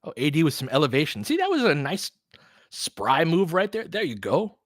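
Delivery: ordinary talk, nothing marked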